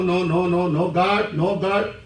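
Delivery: usual speaking voice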